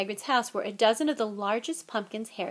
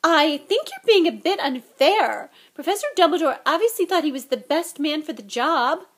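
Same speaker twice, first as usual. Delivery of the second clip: voice higher than usual; 7 semitones higher, 9 dB louder than usual. A narrator's reading. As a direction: rise up high to defend the teacher. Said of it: "in a voice somewhat higher than usual".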